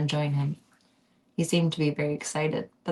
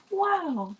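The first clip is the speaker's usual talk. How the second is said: much higher mock wonder; high wow; 13 semitones higher than usual. Reader marvelling at the ending, high wow. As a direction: squeak it up high.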